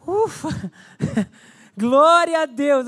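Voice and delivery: high sigh of relief, then laughing glory; relieved praise. Acted. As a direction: breathe out relief, then celebrate.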